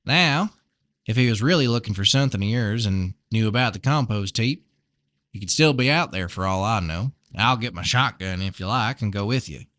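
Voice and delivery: put-on folksy Southern-accented farmer; amateur Southern twang. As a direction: chat like a friendly Southern neighbour. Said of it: "really bad Southern accent".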